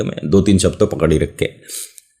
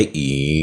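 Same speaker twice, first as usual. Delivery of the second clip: low, soft drawn-out creak made with the mouth; spooky mouth-made creak, low and soft; creaking coffin lid; 7 semitones lower, 7 dB quieter than usual. Shown as a demonstration. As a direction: make the lid creak open. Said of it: "ये कितना अच्छा किया मैंने मुंह से".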